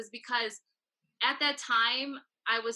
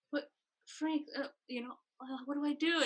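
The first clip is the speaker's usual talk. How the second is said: higher, softer, mildly flustered retelling; uncomfortable; 5 semitones higher, 4 dB quieter than usual. Retold or imitated. Newcomer asking a mentor what to do, mildly flustered. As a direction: stammer lightly, as if put on the spot. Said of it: "I was so uncomfortable".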